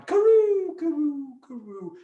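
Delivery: high "karoo", falling and fading; rolling noise. Pretend. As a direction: imitate rolling away downhill. Imitates dreidel dropping down hole.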